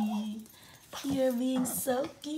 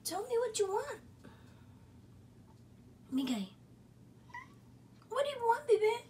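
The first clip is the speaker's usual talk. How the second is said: much higher sing-song pet voice; 10 semitones higher, 7 dB quieter, faster than usual. Real, not acted. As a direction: coo at the dog. Baby-talk to pet.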